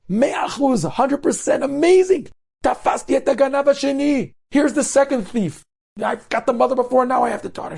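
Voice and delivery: very high-pitched mock triumph; gloating accusation. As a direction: crow over catching the second thief. Cashier publicly mocks the second thief.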